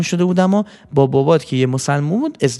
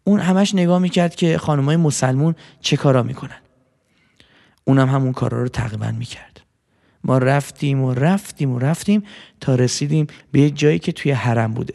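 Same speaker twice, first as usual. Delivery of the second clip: ordinary delivery, nothing marked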